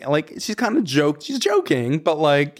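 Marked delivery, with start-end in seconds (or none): none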